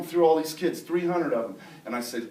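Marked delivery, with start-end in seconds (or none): none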